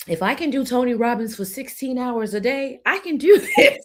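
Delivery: laughing pep talk. Retold or imitated. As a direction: psych yourself up while cracking up.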